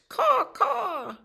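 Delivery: high, loud cawing like a crow. A narrator's reading. Reading the crows' caw to a child, high crow call. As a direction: squawk it out like a crow.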